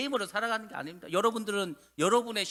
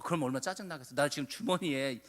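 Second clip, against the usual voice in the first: normal talking voice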